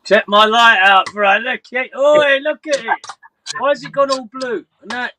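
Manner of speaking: high, eager exclamations; wow, look at this; excited showing off. Real, not acted. Streamer showing his mate the blue boat light, excited and high.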